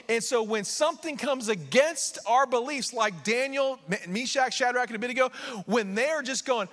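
fervent, high-pitched proclamation; impassioned preaching. Real, not acted. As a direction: drive it forward like a preacher. Preaching at full force.

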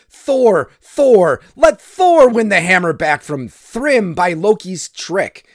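loud, high calls; clamouring crowd. A narrator's reading. Gods in council calling for Thor, loud clamour. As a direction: chant it out like an assembly demanding a champion.

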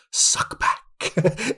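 hissed, creepy whispered voice. Retold or imitated. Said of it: "in, like, this horrible, creepy voice".

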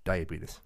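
"Diabetes" ending in "tus", like "die-a-bee-tus". Shown as a demonstration. Proving he was right, says "Diabetes" as "die-a-bee-tus". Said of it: "when you say it, diabetes, you sound like a redneck".

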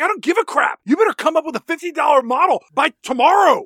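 high, loud hammed-up boss; shouting cartoon boss; mock angry boss. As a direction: bark orders like a tyrant. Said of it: "He's like".

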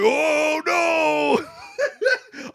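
high, hammed-up cry of dismay; mock wail. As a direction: wail it in exaggerated despair. Acts out the bus's dismay.

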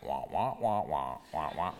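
low, mock adult-drone wah-wah; meaningless droning. To the congregation, mocking jargon as droning noise. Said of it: "all I'm hearing is".

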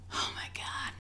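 breathless terrified whisper; whispered dread. Retold or imitated. Scared daughter hiding in her room, whispering in fear. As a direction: mouth it in hushed panic. Terrified, hearing dad come home.

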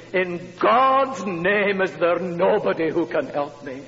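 a slow, lightly voiced desperate plea from a street girl; girl's plea. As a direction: let her longing stretch the first words. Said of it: "this kind of longing as she said".

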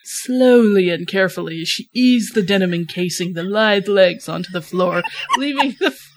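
ordinary delivery

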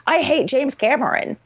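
usual speaking voice

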